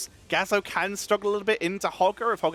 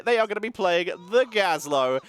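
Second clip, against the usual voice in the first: ordinary talk, nothing marked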